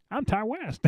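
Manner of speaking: high, thin mock old man voice. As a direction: squeak it like a doddering old man. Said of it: "Sound like an old man".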